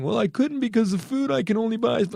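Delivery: high whiny mock excuse. Mocking people who complain about food costs, high whiny voice. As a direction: whine the excuse like a lazy complainer. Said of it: "I'm tired of hearing".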